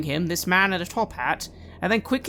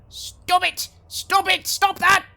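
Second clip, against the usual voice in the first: high shouted stop; shouting; 13 semitones higher, 5 dB louder, slower than usual. Acted. Tavern patrons shouting at the intruder, high shout. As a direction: yell at the commotion.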